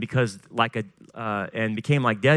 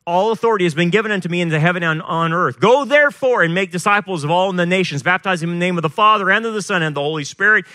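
brisk, raised-voice Bible reading, easing toward the end; scripture proclamation; 4 semitones higher, 5 dB louder than usual. A narrator's reading. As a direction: drive through the commission with preaching force. Reads scripture aloud at force.

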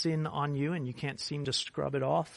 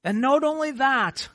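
higher, louder promise building up; full-force preaching; 6 semitones higher, 8 dB louder than usual. Acted. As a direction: proclaim it with rising fervour.

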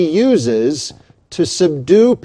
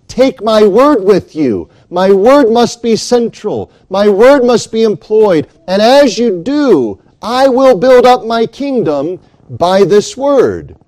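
raised and higher, driving each line home as Christ's command; preaching at full force; 5 semitones higher, 4 dB louder than usual. Acted. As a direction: proclaim it from the pulpit with urgency. Preacher voicing Christ's commission.

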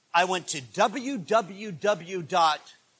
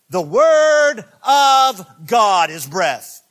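slow, loud, high scripture read; preaching at full force; 5 semitones higher, 10 dB louder, slower than usual. Acted. Preacher proclaiming scripture to his church, slow and loud. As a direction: stretch out and boom the words.

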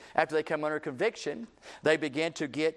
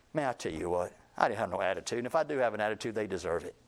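low, quieter, unrepentant aside; walking-away grumble; 6 semitones lower, 4 dB quieter, faster than usual. Acted. Preacher acting someone walking away from an apology, privately unrepentant. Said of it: "When you walk away and say".

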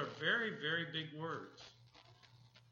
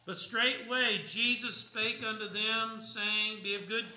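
raised, emphatic Bible reading; scripture reading; 5 semitones higher, 6 dB louder, slower than usual. Acted. Preacher reads the storm story to the congregation, emphatic scripture reading. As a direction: project the verses with preaching force.

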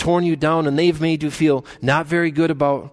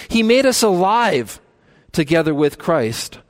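higher, louder rising sermon climax; preaching at full force; 5 semitones higher, 3 dB louder than usual. Acted. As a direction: build it up like a pulpit crescendo.

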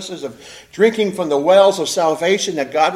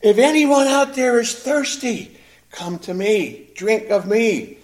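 slow, raised calling-out voice; preacher's mock shout; 5 semitones higher, 3 dB louder, slower than usual. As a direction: call it out slowly to the whole crowd. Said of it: "He's shouting continuously".

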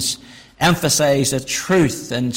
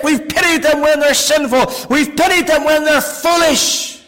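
higher, louder, driving pulpit voice; full-force preaching; 10 semitones higher, 4 dB louder than usual. Acted. Preacher to the congregation about caring for sick children, rising fervour.

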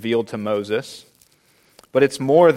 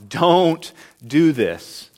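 "don't do this" slow and higher, every word weighted; all-caps emphatic; 4 semitones higher, much slower than usual. Retold or imitated. Preacher voicing God's command in all caps, emphatic. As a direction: stretch "don't" and land hard on each word of "don't do this". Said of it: "in all caps, exclamation mark".

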